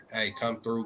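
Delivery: normal talking voice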